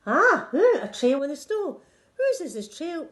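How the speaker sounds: high, loud gasp of wonder fading down; excited discovery. Acted. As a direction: find the trail with delight. Child discovers a trail.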